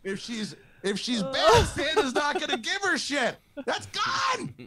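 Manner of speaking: very high squeaky frantic rant; a parent's squeaky inner panic at losing Santa; panicked inner voice. Retold or imitated. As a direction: let the voice in your head spiral in panic. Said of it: "I had that one voice going".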